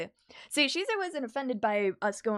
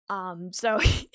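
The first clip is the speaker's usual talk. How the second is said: ordinary delivery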